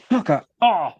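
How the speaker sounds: sudden cramp pain; pained outburst. Real, not acted. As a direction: swear through a leg cramp. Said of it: "a cramp up my calf muscle".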